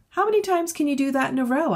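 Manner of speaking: put-on high, eager kid's question; young girl calling out a question. Zara calls question to Alan.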